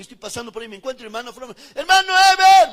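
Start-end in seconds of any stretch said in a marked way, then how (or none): 1.7-2.7 much louder, higher call across the street. Retold or imitated. Sister Flor calling to him on the street, loud higher call. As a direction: hail him boldly. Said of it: "me dice, sin miedo y sin vergüenza".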